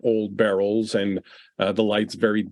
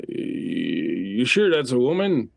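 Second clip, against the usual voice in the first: put-on slow, doubtful drunk voice; a lightly voiced drunk sneering at the woman; drunk second man; 3 semitones higher, slower than usual. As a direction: drawl it out like a sceptical drunk. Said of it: "The other one's voice then follows".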